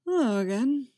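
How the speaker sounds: throaty with sleep. A narrator's reading. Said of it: "throaty with sleep".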